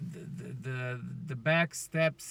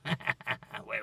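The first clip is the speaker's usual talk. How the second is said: normal talking voice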